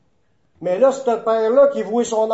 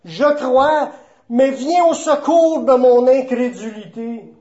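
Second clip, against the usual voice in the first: raised, higher plea; crying out; 4 semitones higher, 5 dB louder than usual. Retold or imitated. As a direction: cry it out like a desperate father's appeal. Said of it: "le père de l'enfant s'écria". (un cri de supplication, fort et plus aigu)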